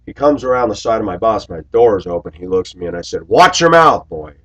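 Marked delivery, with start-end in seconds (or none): 3.3-4.3 high, loud stern warning. Retold or imitated. Driver warning the bully at the door, loud stern. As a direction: warn him off, hard and sharp. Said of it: "just like that".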